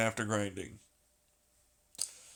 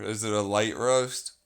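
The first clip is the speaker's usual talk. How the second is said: ordinary talk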